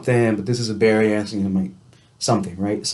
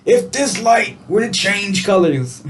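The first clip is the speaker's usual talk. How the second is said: mock impatience; 9 semitones higher, slower than usual. Frustrated at traffic.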